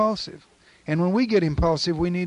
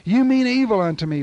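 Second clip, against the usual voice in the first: emphatic, louder and higher, stress on You; declared to the devil; 6 semitones higher, 6 dB louder than usual. Shown as a demonstration. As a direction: say it straight to the devil, hitting You. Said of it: "you can say that to the devil".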